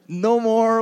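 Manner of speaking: normal talking voice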